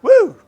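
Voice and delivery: high, loud whoop; short, punchy "woo". Real, not acted. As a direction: let out a sudden high whoop. Reacting to a big question.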